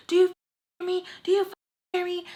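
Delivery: high, fast mock scolding; pissed mom. Mom yelling at daughter on the phone, pissed.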